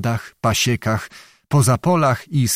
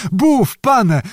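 put-on high-pitched old man's outcry; alarmed cry; 11 semitones higher, 4 dB louder than usual. A narrator's reading. Old beggar sees the hanging threat is serious, cries out high. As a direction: blurt it out in sudden alarm. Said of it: "zawołał dziad, widząc, że nie ma żartów".

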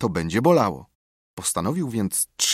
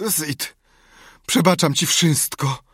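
high dying-patient lament; martyred groaning; 7 semitones higher, 4 dB louder, slower than usual. A narrator's reading. Tomek on his pretend deathbed to Sid, groaning. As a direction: forgive nobly through feigned pain. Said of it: "Jęk".

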